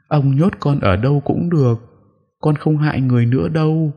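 high, pleading ghost with a mild sob; a kneeling ghost begs the exorcist to spare her; sobbing plea. Acted. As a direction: kneel and beg, voice lifted in distress. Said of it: "khóc nấc lên từng tiếng. Nó quỳ xuống van xin".

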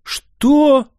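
sharp, high astonished "What?"; astonished blurt. Acted. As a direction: blurt it out in shock. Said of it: "изумлённо выпалил я". (изумлённый возглас)

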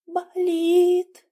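high, slow pained moan; pained struggle to speak. A narrator's reading. Patient tells doctor it hurts, struggling to speak. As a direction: drag the word out high, as if it costs effort. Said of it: "с трудом выговорила она".

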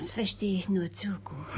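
soft voiceless agreement; knowing whisper. Acted. Sister agrees about the stingy father, whispering. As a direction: whisper with quiet sympathy.

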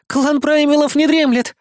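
high, strained woman's voice; scared young woman forcing out a threat; frightened recital. A narrator's reading. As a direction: declaim it in a high panic. Terrified hostage repeats the message.

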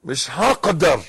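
loud fallahi accent on هقدر; Egyptian peasant accent. Shown as a demonstration. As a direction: say هقدر like an Egyptian villager, loudly. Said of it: "بالفلاحي".

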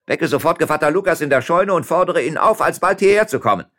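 loud, high-pitched command; shouted order. A narrator's reading. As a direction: keep calling across the house with urgency. Mayor shouts order to servant.

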